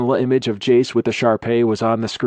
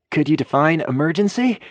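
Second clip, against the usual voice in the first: rising high; 7 semitones higher than usual. A narrator's reading. Nervous Carter squeaking up to a high note. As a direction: voice climbs high at the end. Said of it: "His voice got so high at the end".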